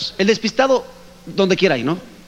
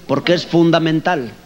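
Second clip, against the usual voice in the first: normal talking voice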